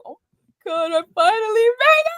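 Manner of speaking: mock overwhelmed winner. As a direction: play the co-host overcome on stage. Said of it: "you gonna be like".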